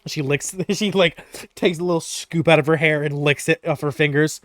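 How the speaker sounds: ordinary talk, nothing marked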